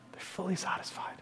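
very soft whisper. Acted. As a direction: let it fall to a whisper of contentment. Preacher hushes on the climax.

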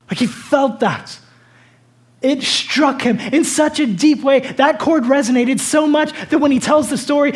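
high, loud, impassioned sermon delivery; full-force preaching. Real, not acted.

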